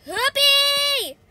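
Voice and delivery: high, loud cheer; excited whoop. Real, not acted. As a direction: whoop it out in delight. Cheering during game attack.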